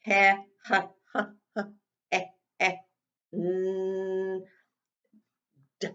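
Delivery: ha ha, eh eh, mm, d, each sound apart; sounding out hands. Shown as a demonstration. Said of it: "Let's stretch it out".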